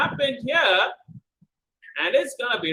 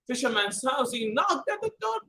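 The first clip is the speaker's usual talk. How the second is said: plain reading voice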